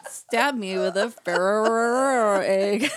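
slow, laughing through the words. Real, not acted. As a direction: giggle it out slowly. Laughing joke.

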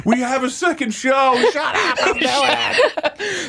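high, slow, laughing defiance; mock stubborn comic. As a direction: refuse to leave the stage, pitched high. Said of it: "You're crying like".

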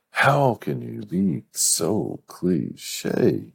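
very slow, drawn-out high complaint; mock exasperation. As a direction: stretch each word in playful disbelief. Scolding the author over the cliche.

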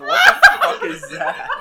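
high, loud, slow shocked yell; shocked wife shout. Retold or imitated. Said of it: "my wife going".

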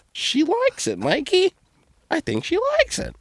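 very high, squeaky put-on voice; teasing mock falsetto. Taunting the husband about his wife, squeaky mock falsetto.

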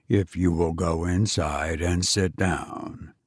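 low, soft, even machine voice; an unmoved robot repeating its order. Acted. Robot calmly answering a frantic parent, low and soft.

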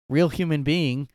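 normal talking voice

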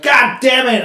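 high, loud frustrated yell; angry shout. Retold or imitated. Dead Jeep won't start, frustrated shout. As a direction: slam it out in frustration. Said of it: "I'm so goddamn mad".

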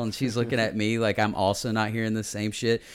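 ordinary talk, nothing marked

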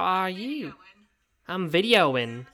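high, slow sing-song greeting; mock nursing home worker. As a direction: coo at the bear like a care worker. Worker greets bear while filming.

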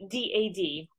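ordinary talk

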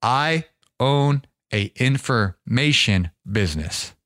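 slow and weighted, each word spelled out; slow, emphatic repeat of "I own a information business"; slow, deliberate restatement. Shown as a demonstration. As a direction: say it again slowly so it sinks in. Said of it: "I went over a lot of your heads".

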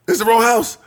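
hammed-up woman's voice; voicing the woman. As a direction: play her outraged.

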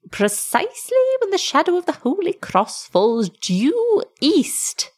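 high, swooping dwarf voice; crying dwarf. A narrator's reading. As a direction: call out the appointed hour in piping tones. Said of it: "cried the dwarf".

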